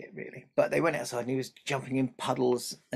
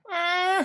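high loud mock crying wail; baby wail; 7 dB louder than usual.

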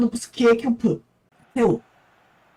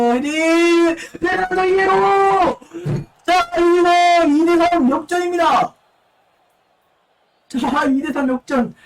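esports caster; 8 semitones higher, 4 dB louder, slower than usual. Acted. Header and comeback call.

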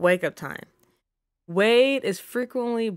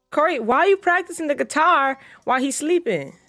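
high, louder scold; exasperated scolding; 7 semitones higher, 4 dB louder than usual. Real, not acted. Player scolding Corey for guitar while baby sleeps, exasperated. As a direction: call her out, fed up.

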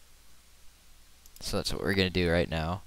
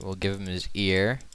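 normal talking voice

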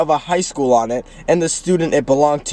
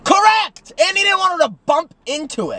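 excited; 13 semitones higher than usual. Real, not acted. Excited agreement.

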